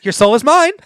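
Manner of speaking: lightly voiced sorcerer villain; Shang Tsung impression. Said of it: "Here's my Shang Tsung impression".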